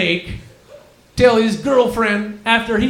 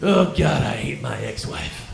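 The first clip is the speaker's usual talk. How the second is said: low grumbling voice; 10 semitones lower, 3 dB quieter than usual. Acted. Sudden low bitter character voice.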